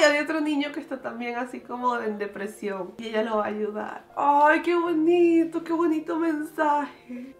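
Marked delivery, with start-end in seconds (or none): 4.1-7.3 slow, high, stretched-out gushing; real touched exclamation; moved delight. Real, not acted. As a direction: gush with tender joy. Touched by the video ending.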